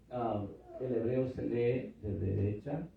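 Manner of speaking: ordinary delivery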